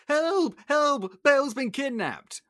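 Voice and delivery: high, loud cries for help; acted frantic shouting; panicked Maurice. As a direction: burst in raising the alarm. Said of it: "Maurice bursts in".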